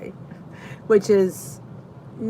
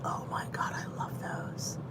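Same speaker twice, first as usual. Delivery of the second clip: whisper; 14 dB quieter than usual. Real, not acted. Excited over a favourite treat.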